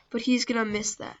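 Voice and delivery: ordinary talk, nothing marked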